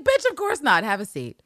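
high, loud, sassy refusal; mock menace. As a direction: toss it off like a smug killer. Villanelle refusing to leave.

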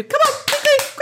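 high brisk hurry-up; hurrying teacher. Pretend. Friend chiming in with a brisk hurry-up. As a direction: chivvy them along. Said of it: "a few claps like that".

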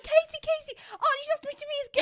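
high, loud, fast squeal; excited squeal. Retold or imitated. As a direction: rattle it off in a giddy rush. Retelling her excited rush.

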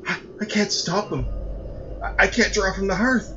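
high, stammering sob in the voice; weeping. A narrator's reading. Son weeping to his mother, high and tearful. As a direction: plead through tears, voice cracking. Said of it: "Flynn wept".

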